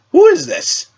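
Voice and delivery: high surprised who is this; startled. Acted. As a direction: blurt it out in surprise. Surprised at metal man.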